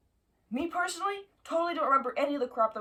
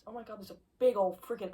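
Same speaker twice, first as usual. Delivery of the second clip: ordinary delivery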